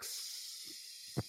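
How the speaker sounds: hissing sizzle through the teeth; bacon sizzling. Shown as a demonstration. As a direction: imitate bacon frying in a pan. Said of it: "could do, like, bacon sizzling".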